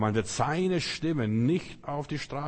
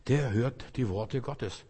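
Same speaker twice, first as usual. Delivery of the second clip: ordinary talk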